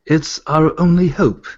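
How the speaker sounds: slow, proper British delivery; measured British accent, drawn out. Shown as a demonstration. Voice actor trying the audition line, British accent. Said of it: "let me do the British one".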